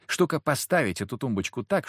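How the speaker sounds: usual speaking voice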